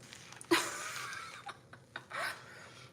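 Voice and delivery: real high-pitched, wordless laughing fit; laughing at the term. Real, not acted. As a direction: crack up helplessly at a silly word. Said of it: "Is anybody else laughing at that term".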